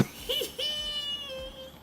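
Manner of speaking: high-pitched, drawn-out laugh. Real, not acted. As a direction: laugh high at an absurd answer. Laughs at the played answer.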